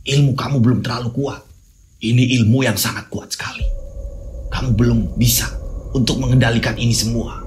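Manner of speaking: a grandfather taking charge of the magic; old grandfather. Acted. Grandfather voice held.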